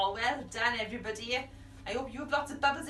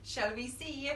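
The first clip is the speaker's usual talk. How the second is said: usual speaking voice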